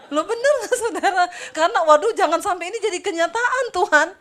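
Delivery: high, loud and laughing; preacher laughing at her own fear, loud. Real, not acted. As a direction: laugh through the plea. Laughing, pleading to congregation.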